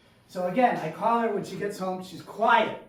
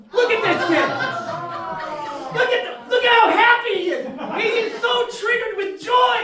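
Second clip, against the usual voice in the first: high, loud, overexcited yelling; gleeful shouting; 12 semitones higher, 8 dB louder than usual. Acted. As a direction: crow it at the top of your voice. Acted excitement over hooked kid.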